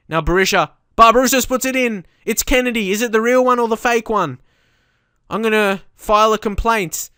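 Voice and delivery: high, loud match-commentary excitement; excited yelling. Real, not acted. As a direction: yell at the screen as chances come. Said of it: "I just reacted so badly".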